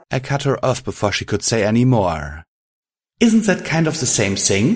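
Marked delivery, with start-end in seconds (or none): none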